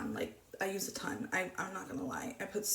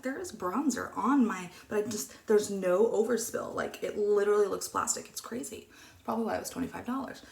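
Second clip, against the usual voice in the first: normal talking voice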